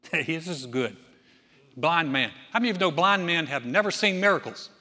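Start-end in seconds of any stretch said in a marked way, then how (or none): none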